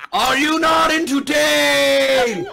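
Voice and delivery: huge, high, drawn-out bellow; mock-heroic shout. Host challenging his guests after a joke, mock-heroic bellow. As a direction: roar it slowly to the whole arena.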